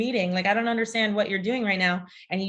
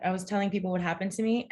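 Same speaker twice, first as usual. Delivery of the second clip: normal talking voice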